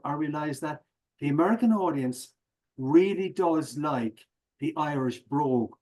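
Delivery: normal talking voice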